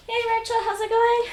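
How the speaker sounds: loud, high-pitched hello to a friend; excited greeting. Real, not acted. Streamer welcomes Rachel dropping into the stream, excited greeting.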